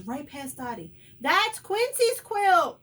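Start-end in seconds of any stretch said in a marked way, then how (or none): 1.2-2.8 slow, high, very loud holler; quacking shout. A narrator's reading. Duck spots dog running off with quilt, quacking shout. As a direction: blare it out like an alarmed duck. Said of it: "quacks Dottie".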